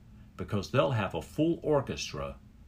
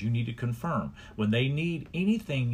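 ordinary talk, nothing marked

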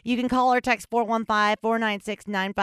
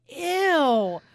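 high, drawn-out ew; disgusted; 8 semitones higher than usual. Retold or imitated. Reacting to her old drunk video, disgusted groan. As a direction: recoil in mock disgust. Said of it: "It was disgusting".

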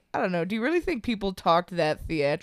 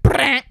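sudden high yelp of shock; electric zap yelp; 6 semitones higher, 6 dB louder than usual. Retold or imitated. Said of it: "my whole shoulder was like".

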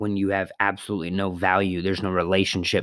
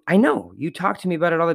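upbeat retort; 8 semitones higher, faster than usual. Retold or imitated. Speaker quotes himself to Randy.

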